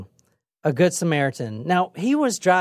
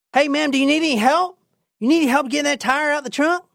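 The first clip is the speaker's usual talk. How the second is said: high, quick Southern-style call; helpful truck driver; 9 semitones higher, faster than usual. Acted. Driver to woman changing a tire, calling out helpfully. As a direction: call out an offer of help, fast and high.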